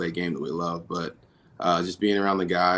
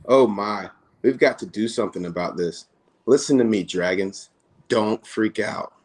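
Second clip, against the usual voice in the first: louder, higher, lively storybook read-aloud; reading to children; 4 semitones higher, 6 dB louder, slower than usual. Acted. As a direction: read it big and animated for the kids at the event. Reads picture book to children.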